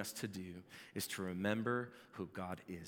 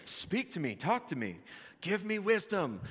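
pleading to an idol; 9 semitones higher, 4 dB louder than usual. Retold or imitated. Idol worshipper crying out to a lifeless statue, mock pleading. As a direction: beg the idol like a desperate worshipper. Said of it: "you're crying out to it".

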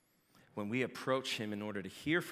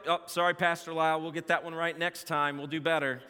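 a lightly voiced meek referee, higher and louder; apologetic referee; 7 semitones higher, 8 dB louder than usual. Imagined referee apologizing to the pastor, lightly mock-sorry. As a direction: play the ref sheepishly saying sorry.

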